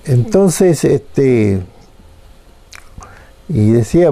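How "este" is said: ordinary delivery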